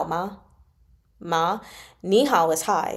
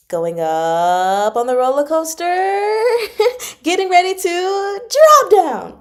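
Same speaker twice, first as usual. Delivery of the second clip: exaggerated rising tone; 11 semitones higher, 8 dB louder than usual. Shown as a demonstration. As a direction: voice climbing high and loud, laughing at the top. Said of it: "I'm exaggerating".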